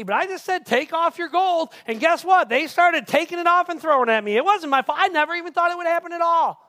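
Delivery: loud, high, escalating excuse-making; defensive. Acted. Aaron explains the gold to Moses, loudly defensive. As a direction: protest innocence in a rising fluster.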